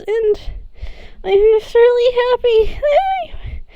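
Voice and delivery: high, tearful, choked speech; tearful high voice of relief; happy crying. Acted. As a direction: speak through happy tears. Sammy crying happy tears.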